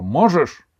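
high, loud single burst; shouted retort. A narrator's reading. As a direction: yell it back sharply. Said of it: "Аделаида крикнула".

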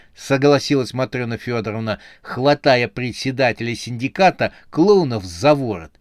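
plain reading voice

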